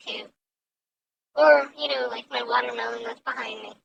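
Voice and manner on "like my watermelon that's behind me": whispered aside; whispering. Real, not acted. Aside about her own art.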